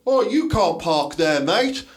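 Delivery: a hint of a grumpy old bloke; mock scold the parked driver, slowly; old bloke in a staged video telling off a parker, lightly voiced; imitating an old bloke. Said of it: "some fucking old bloke will come and knock on someone's window and go".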